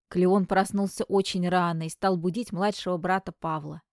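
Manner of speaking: ordinary delivery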